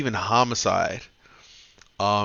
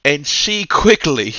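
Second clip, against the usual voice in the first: excited; 8 semitones higher, 9 dB louder than usual. Real, not acted. Climax of story retelling.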